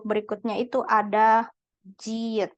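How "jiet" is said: ordinary delivery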